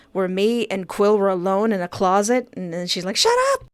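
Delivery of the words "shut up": very high, sudden yell; exasperated yell. Acted. Fia silences Quill's oversharing, high exasperated yell. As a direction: cut them off with a squeal. Fia cuts off reader's joking confession.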